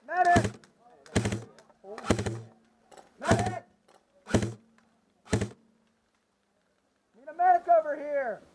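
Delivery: man down shout. Real, not acted. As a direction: call for a medic while down. Downed player calling repeatedly.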